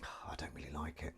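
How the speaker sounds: very soft, low sighing murmur; hushed admission after a sigh; confiding whisper. Real, not acted. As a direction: confide it under your breath.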